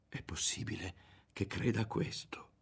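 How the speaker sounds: hushed, whispered inner thought; wondering to himself. A narrator's reading. Ario wondering about his mother's accusation, whispered to himself. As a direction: whisper it privately, in disbelief. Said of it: "si domandava Ario".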